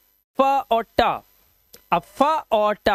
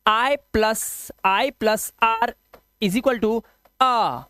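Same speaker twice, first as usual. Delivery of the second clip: slow dictation; much slower than usual. Shown as a demonstration. Teacher dictating the formula for the register, slowly. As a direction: rule spelled out slowly for notes. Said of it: "formula अपने register में note कीजिए".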